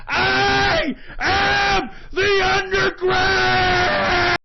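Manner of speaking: tearful roar that slows to a crawl; crying shout. Real, not acted. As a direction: scream it defiantly, stretching the last one. Climactic defiant sign-off.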